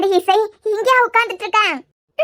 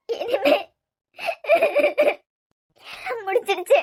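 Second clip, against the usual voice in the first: tearful sobbing; 2 semitones higher, 6 dB quieter than usual. Acted.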